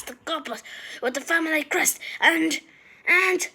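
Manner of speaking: rising, gulping breaths; gasping for air. A narrator's reading. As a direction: build up, gasping. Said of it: "Creature was gulping for air".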